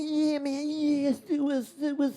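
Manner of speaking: high, drawn-out whooping mimicry; Des Bishop impression. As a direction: mimic a thrilled swimmer far out at sea. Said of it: "All I could see him was just there going".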